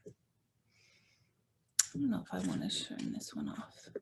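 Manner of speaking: hushed, near-whispered aside; low-pitched, barely voiced murmur; quiet aside. Real, not acted. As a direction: mumble it softly to yourself, quickly. Quiet aside about the lights.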